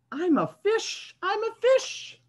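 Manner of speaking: very high, playful pigeon voice; high, slowing pigeon announcing he's a fish. Acted. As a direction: proudly pretend to be a fish. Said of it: "but he says".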